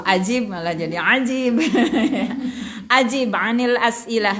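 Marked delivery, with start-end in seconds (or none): 1.6-2.8 short amused laugh; a brief chuckle; real laugh. Real, not acted. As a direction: laugh at the student's mix-up. Class laughs at the mix-up.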